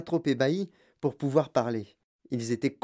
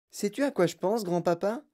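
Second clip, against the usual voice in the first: slightly raised eager question; mildly excited cry; 5 semitones higher than usual. A narrator's reading. Charlie shares his idea with grandpa, mild excitement. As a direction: ask grandpa with a touch of excitement. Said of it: "s'écria Charlie".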